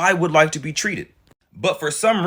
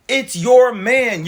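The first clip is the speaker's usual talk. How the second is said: slow, loud and higher, leaning on your; emphatic point; 6 semitones higher, 5 dB louder, much slower than usual. Real, not acted. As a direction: drive home that it's YOUR man. Lecturing viewers on blame.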